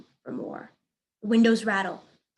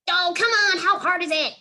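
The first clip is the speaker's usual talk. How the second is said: high, loud impatient yell; yelling bunny; 9 semitones higher, 7 dB louder than usual. Acted. The bunny yelling at Bob from the hay pile. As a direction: shout in exasperation. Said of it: "yells the bunny".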